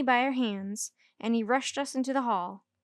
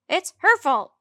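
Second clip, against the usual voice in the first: loud accusing shout; 7 semitones higher, 10 dB louder than usual. A narrator's reading. Girls blaming each other to the teacher, loud shout. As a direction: point and shout the blame. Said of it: "we shouted together".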